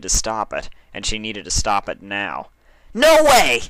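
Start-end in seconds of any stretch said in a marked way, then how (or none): 2.9-3.6 sudden loud high outburst; shout. Acted. Dash refusing her partner, shouting. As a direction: blurt it out at full volume. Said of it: "Dash shouted abruptly".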